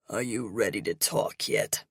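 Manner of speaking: deep, commanding biker voice; menacing low growl; gravelly commanding captor. Acted. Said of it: "a voice booms".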